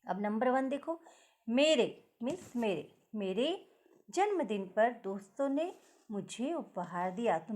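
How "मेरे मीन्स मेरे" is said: ordinary talk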